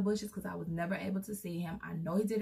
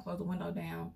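normal talking voice

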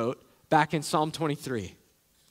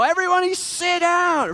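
high, loud call to a crowd; mock command; 12 semitones higher, 5 dB louder than usual. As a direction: bark it out like a host at a barbecue. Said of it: "You don't normally go".

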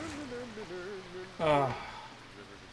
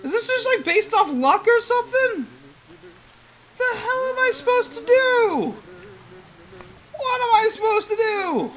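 exasperated; 12 semitones higher than usual. Real, not acted. Stuck, exasperated at the game.

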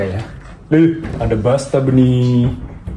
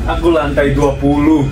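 ordinary delivery

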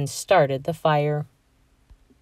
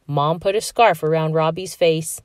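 plain reading voice

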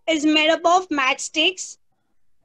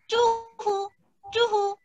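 sustained hoots, softer and raised; owl hoot; 5 semitones higher, 5 dB quieter than usual. Shown as a demonstration. Teacher showing children the sound of owl, hooting. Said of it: "sound of owl".

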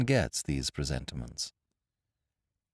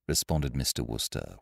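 ordinary talk, nothing marked

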